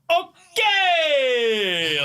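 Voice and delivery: put-on high, long-drawn foreign-accent call; hammed-up catchphrase shout; Borat. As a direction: imitate a coworker's Borat call, stretched out and sliding down. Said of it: "he'll walk in the room and go like".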